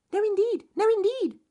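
very high, tiny twittering voices; twittering mice. Acted. Field mice agreeing with the badger, twittering. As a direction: chirp it like little mice. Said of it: "twittered the field mice".

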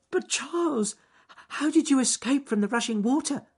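high, quick, excited little voice; eager rabbit. Acted. Joyful rabbit asks the bear how he escaped, high and quick. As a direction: ask breathlessly.

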